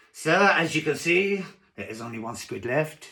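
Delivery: badly faked French, giving out by the end; shaky attempt at French accent. Waiter shows diner the tank, faltering French accent. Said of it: "I can't do a French accent".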